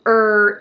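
er as a long r-coloured vowel; er with the R sounded; second sound alone. Shown as a demonstration. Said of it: "イーアールのerですね".

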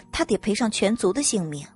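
ordinary delivery

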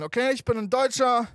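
normal talking voice

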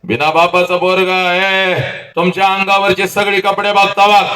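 high, sustained oratorical cry; impassioned oration. Acted. Speaker rousing students about their fathers, high impassioned cry. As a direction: belt it out in long rising-pitch phrases.

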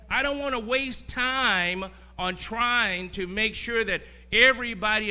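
loud, slow, driving pulpit delivery; full-force preaching. Acted. Preacher driving the point home to the church, loud. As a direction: preach it out to the congregation.

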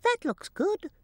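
high chirpy bird voice; blackbird voice. Acted. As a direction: peep it like a little bird. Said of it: "Said a blackbird".

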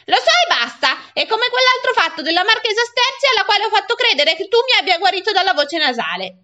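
put-on high, insistent boy arguing back; indignant boy.

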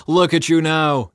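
loud, high jeering shout; mocking yell. A narrator's reading. Crowd to Jesus on the cross, jeering yell. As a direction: jeer up at him. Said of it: "they yelled at him".

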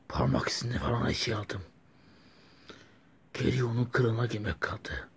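hushed villain gloat; scheming whisper. Acted. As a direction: breathe the plan out secretly.

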